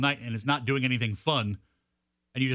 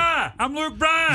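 much higher and louder, slow, stretched out; 10 semitones higher, 4 dB louder, much slower than usual. Shown as a demonstration. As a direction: yell the name like a radio promo. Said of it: "you gotta yell".

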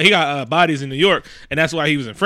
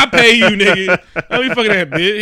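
laughing mock scold; 4 semitones higher than usual. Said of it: "He's like".

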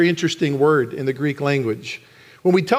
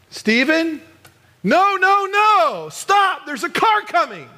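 urgent warning shout; 13 semitones higher, slower than usual. Shown as a demonstration. Parent warning child of an oncoming car, urgent shout. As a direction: shout no and stop as if danger is coming. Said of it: "which one's the most energetic".

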